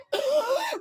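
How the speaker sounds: high, sharp intake of breath; mock startled gasp. Retelling a knight waking on the battlefield, mock gasp. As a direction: gasp as if jolted awake.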